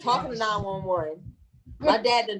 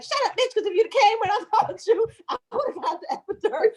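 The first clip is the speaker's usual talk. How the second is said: high, laughing mock yell at her sister; 11 semitones higher, faster than usual. Woman in labor laughingly yelling at her sister, high mock yell. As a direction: snap at her through laughter, pitched up high.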